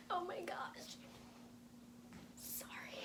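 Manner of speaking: breathless fangirl whisper with giggles; excited whisper. Real, not acted. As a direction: hush it out, barely holding in the joy. Overwhelmed fan whispering excitement.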